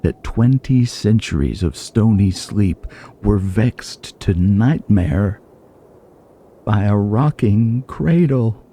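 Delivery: slow, solemn poetry reading with long pauses; poem recitation. Acted. Gamer opening the video by reciting Yeats, slow and solemn. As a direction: intone each line slowly, like a prophecy.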